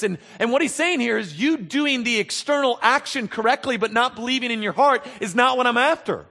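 high, loud, impassioned preaching build; a preacher at full force; fiery preaching. Acted. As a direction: drive the rebuke home from the pulpit.

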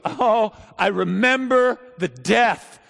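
high, loud anguished lament; raw, pained preaching at full force; anguished preaching cry. Acted. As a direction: cry out the memories of war as Jeremiah. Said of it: "And he goes".

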